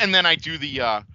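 ordinary talk, nothing marked

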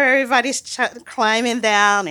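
ordinary delivery

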